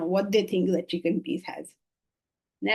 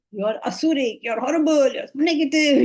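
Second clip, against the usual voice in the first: rising, louder mock accusation; mock condemnation; 4 semitones higher, 3 dB louder than usual. Teacher imitating the verse scolding us, mock condemnation. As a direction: pile up the charges in playful exaggeration.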